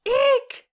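very high shrill eek like a horror violin; shrieking eek. Pretend. Mimicking a slasher film stab for the co-host, high squeal. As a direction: shriek it like the Psycho strings.

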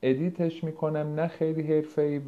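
usual speaking voice

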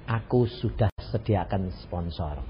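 low, slow, calm divine answer; voice of God. Retold or imitated. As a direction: speak low and unhurried like God. Suara Tuhan menjawab. (suara Tuhan rendah, pelan dan tenang)

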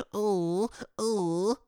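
a hammed-up imitation of Kim's bedroom moan; mocking moans. Mocking how Kim sounds in bed, hammed-up moans. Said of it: "That's what you sound like".

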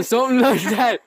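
words squeezed through high laughter; laughing. Real, not acted. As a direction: crack up mid-sentence. Botches the cracker joke.

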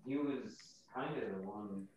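softly spoken, sinking almost out of hearing; quiet. Real, not acted. Player voices his character too far from the mic, quiet. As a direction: let the voice fade away from the mic. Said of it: "you're really quiet all of a sudden".

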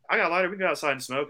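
high-pitched offer; imitated woman. Woman on the lobby floor offers a lighter, high imitated voice.

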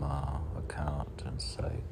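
usual speaking voice

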